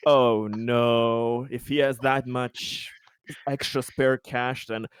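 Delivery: ordinary talk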